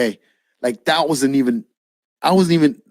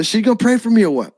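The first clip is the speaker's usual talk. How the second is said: lightly voiced pushy woman, higher and quick; quick, high-pitched retold challenge; rough soup-kitchen lady; 8 semitones higher, faster than usual. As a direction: imitate her brash demand. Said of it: "Rough soup kitchen lady".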